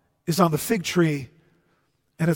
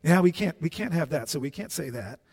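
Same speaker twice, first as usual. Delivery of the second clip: softer and softer, conspiring; hushed huddle; 3 semitones lower, 7 dB quieter than usual. Retold or imitated. Priests deciding in their huddle, fading hush. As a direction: trail off as you plot in secret. They have a little conference.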